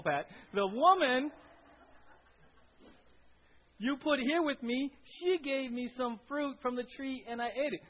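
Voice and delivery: high, loud, whiny Adam passing the blame; Adam blaming. Acted. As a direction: pin it on the woman, pleading.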